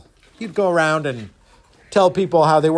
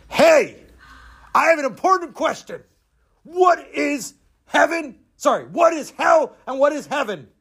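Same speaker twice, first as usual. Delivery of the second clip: demanding samurai; 10 semitones higher than usual. Acted. Samurai questioning the Zen master, brash and demanding. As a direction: blurt the big question high and forceful.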